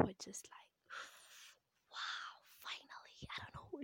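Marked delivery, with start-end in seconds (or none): 1.9-3.8 breathy whispered imitation; soft, voiceless mock amazement; hushed crowd awe. Acts out the crowd's hushed reaction.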